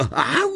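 high sudden "ow"; pained yelp. A narrator's reading. Customer hit on the head mid-question, high pained yelp. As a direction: yelp as if struck without warning. Something lands on Ron's head.

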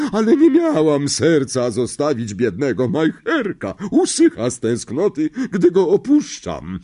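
put-on high, loud, overbearing old aunt; a big domineering aunt who loves her dog; bossy aunt. A narrator's reading. Voices Aunt Marge. (władcza ciotka, wysoki głos)

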